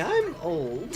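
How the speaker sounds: Aunt May voice. Acted. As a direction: squeak it out like a doddering aunt. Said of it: "My Aunt May voice".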